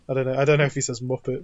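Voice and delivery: normal talking voice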